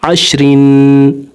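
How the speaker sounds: अशरिन with a light, thin रा, slowly. Shown as a demonstration. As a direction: keep the रा in अशरिन fine and light. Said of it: "ये रा बारीक पढ़ी जाएगी". (अशरिन में रा बारीक)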